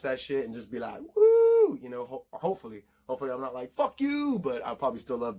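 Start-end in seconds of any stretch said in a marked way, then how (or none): 1.1-1.8 high loud whoop; excited "woo". Pretend. Imagining watching the Marvel movie, excited high whoop. 3.7-4.4 high drawn-out mock curse; a playful high-pitched "fuck you"; mock outburst. Fan imagining letdown by the film, mock outrage.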